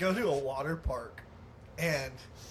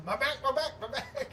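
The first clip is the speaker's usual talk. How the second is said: mock scream; 9 semitones higher than usual. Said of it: "as he's going down screaming".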